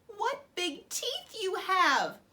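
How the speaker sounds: put-on girl realising grandmother is the wolf; alarmed girl. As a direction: stretch it out in growing fear. Girl alarmed by wolf's teeth.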